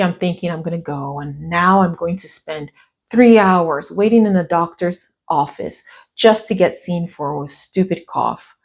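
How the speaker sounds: ordinary talk, nothing marked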